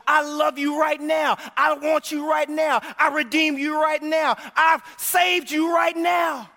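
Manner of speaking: high, loud, building preacher's cry; preaching at full force. Acted. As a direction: lift it to a shouted proclamation. Preacher voicing God at full force.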